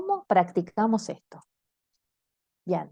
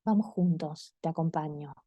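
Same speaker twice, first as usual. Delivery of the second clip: gentle comfort; 3 semitones lower, 4 dB quieter than usual. Shown as a demonstration. Comforting a frightened person.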